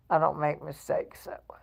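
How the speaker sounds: hushed, unvoiced repeat; whispered aside. Real, not acted. Host repeating the boast to viewers, whispered. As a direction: drop to a low whisper.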